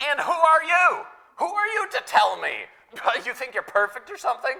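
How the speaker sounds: loud, high, offended comeback; indignant retort. Retold or imitated. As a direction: snap back, wounded pride, loud. Indignant retort to being corrected.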